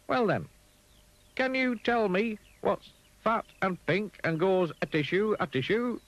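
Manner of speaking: high and brightly sure; high Mister Dizzy. Acted. Mister Dizzy answers the pig, high and sure. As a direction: answer with easy confidence.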